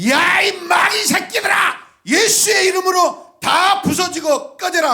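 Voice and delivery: furious high shout, slow and drawn out; screaming rebuke at demons; exorcism shout. Retold or imitated. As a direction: roar at the demons to be gone. Casting out demons, throwing beads.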